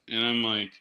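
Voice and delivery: ordinary talk, nothing marked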